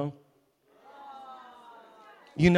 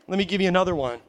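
normal talking voice

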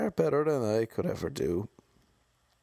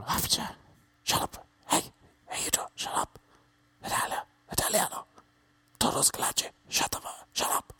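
whispered shushing; 4 semitones higher than usual. Retold or imitated.

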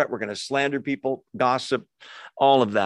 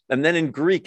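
normal talking voice

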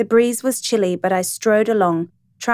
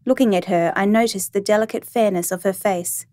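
ordinary delivery

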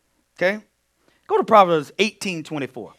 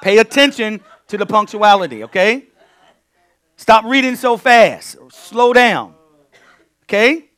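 louder, firm correction to the reader; 4 semitones higher, 8 dB louder than usual. Real, not acted. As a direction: pull the reader up firmly, a bit louder.

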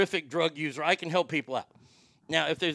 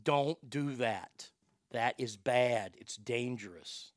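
mock warning to kids; 5 semitones lower, 6 dB quieter, slower than usual. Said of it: "There you go, kids".

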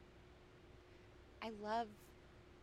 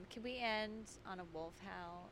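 ordinary talk

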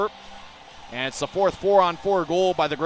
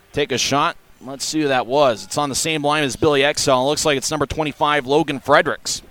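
rapid hockey play-by-play; 3 semitones lower, 5 dB louder than usual. Real, not acted.

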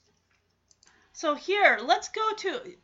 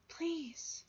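soft pleading; 4 semitones higher, 14 dB quieter than usual. Acted. Pleading with unresponsive grandma.

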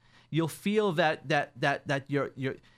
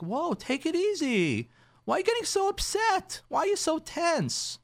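high mock-alarmed wife voice; lightly voiced wife pulling back in alarm; startled wife; 12 semitones higher than usual. Wife recoiling from husband's outburst, high and mock-alarmed.

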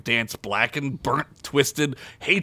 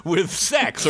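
plain reading voice

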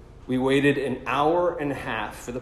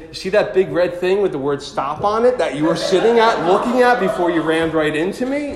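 loud, high, heated retort; going all Italian; 4 semitones higher, 7 dB louder than usual. Pretend. Imagined comeback to the driver who rammed him, loud and heated. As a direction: let her have it, hot-blooded. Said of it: "go all Italian and say".

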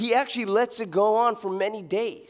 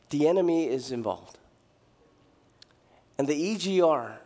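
ordinary talk

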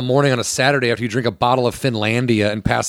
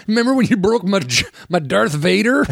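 higher, whiny teasing dad; mock dad whine; 10 semitones higher than usual. Dad at Thanksgiving teasing his grown sons, whiny. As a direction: needle the kids like a sulky father. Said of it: "you can be like".

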